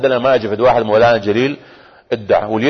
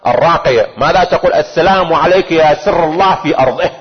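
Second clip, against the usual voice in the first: higher-pitched, insistent sermon delivery; impassioned preaching; 6 semitones higher than usual. Acted. Preacher questioning the congregation about the Imam's secret, impassioned preaching. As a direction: press each question on the congregation.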